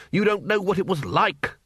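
normal talking voice

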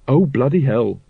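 ordinary delivery, nothing marked

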